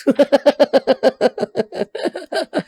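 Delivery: long, high run of laughter; giddy laugh. Real, not acted. Laughs about loving dollhouses.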